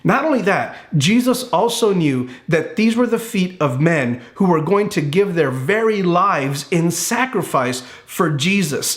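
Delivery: ordinary delivery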